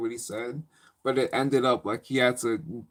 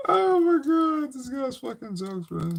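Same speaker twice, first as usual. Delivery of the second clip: laughing hard; 13 semitones higher, 3 dB louder than usual. Real, not acted. Cracking up at teacher's jokes.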